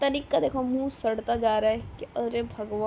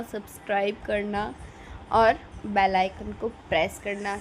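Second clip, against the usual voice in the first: usual speaking voice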